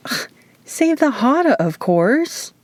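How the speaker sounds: high exasperated ugh then a dismissive drop; annoyed. A narrator's reading. Izzy answering Luke's question, annoyed and dismissive. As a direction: roll your eyes at the obvious question. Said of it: "made an annoyed sound in the back of her throat".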